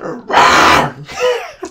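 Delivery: high mock roar; imitated growl. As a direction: growl like two players squaring up. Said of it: "You see them growling".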